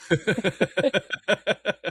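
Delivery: a high breathy laugh breaking out; real amused laugh. Real, not acted. Laughing at own nervous quip.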